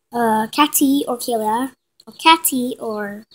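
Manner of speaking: ordinary delivery, nothing marked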